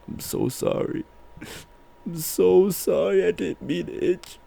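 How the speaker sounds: high, tearful mock apology; retold tearful apology. Telling how they apologised to a friend, mock tearful. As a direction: plead high and weepy, imitating yourself. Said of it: "then I just started crying".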